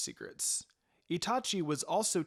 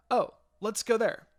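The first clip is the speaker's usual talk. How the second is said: higher and louder, eager call; excited; 7 semitones higher, 7 dB louder than usual. A narrator's reading. Minako pulling Itachi to the food contest, excited and loud. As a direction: burst out with delight at the contest.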